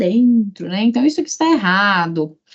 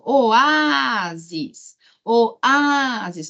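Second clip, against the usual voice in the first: oÁÁÁsis, Á stretched out both times; calling the word; much slower than usual. Shown as a demonstration. Teacher showing a paroxytone, calling the word. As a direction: call out Oásis, holding the Á. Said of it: "chama a palavra".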